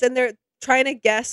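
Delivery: ordinary talk, nothing marked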